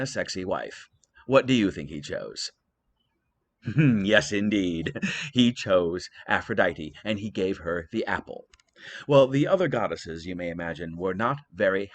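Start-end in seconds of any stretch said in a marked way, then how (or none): none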